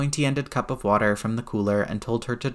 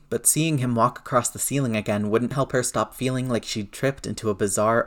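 ordinary delivery, nothing marked

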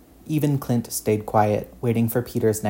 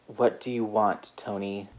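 rasped; 6 dB quieter than usual. A narrator's reading. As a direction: scrape the words out tired and quiet. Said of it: "Steve rasped".

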